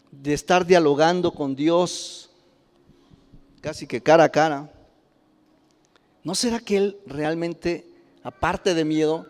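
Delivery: ordinary talk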